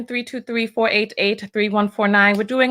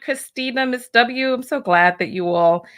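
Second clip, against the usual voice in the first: ordinary talk, nothing marked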